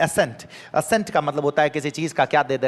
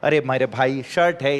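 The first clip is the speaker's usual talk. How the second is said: normal talking voice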